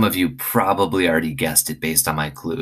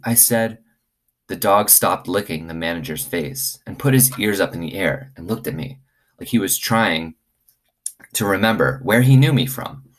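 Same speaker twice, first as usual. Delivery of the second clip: lively read-aloud for the class; story reading to children; 3 semitones higher than usual. Acted. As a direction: tell it like a bedtime story to kids. Reads chapter one aloud to class.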